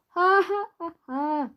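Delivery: high, loud manic cackle; crazed laugh. Acted. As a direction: laugh like he's gone bananas. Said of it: "The man began to laugh".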